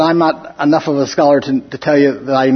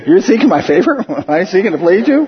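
higher pitch, laughing between phrases; amused mock question; 4 semitones higher than usual. Teacher joking about Paul pleasing those he rebukes, laughing mock disbelief. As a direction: ask it incredulously through chuckles.

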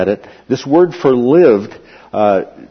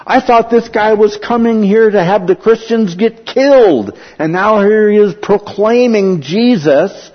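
incredulous crowd; 8 semitones higher, 3 dB louder than usual. Acted. Crowd voiced in disbelief.

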